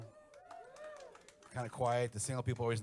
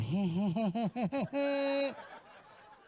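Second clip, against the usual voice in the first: put-on high, loud mischievous kid cackle; imitated child's giggle; 13 semitones higher, 7 dB louder than usual. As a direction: cackle like a bratty little boy. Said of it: "I'd see the little one come out".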